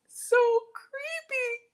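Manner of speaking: drawn-out high mock creepy voice; mock spooky. Joking about gingivitis vampire cookies, mock spooky wail. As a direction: stretch it out like a ghost.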